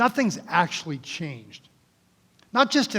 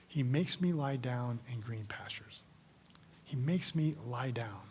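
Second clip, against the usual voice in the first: hushed, unvoiced recitation of the verse; whispered meditation; 5 semitones lower, 13 dB quieter than usual. Shown as a demonstration. Pastor whispering the psalm as a meditation demo. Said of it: "may lead you to do something absolutely crazy like this".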